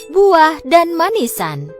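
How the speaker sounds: ordinary delivery, nothing marked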